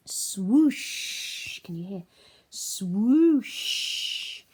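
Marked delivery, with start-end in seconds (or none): 0.1-1.6 loud rising whoosh; whooshing wind. Acted. Wind hitting the house, loud whoosh. 2.5-4.4 loud rising whoosh; whooshing wind. Acted. Wind hitting the house, loud whoosh.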